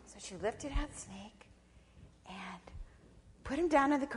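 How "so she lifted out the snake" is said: plain reading voice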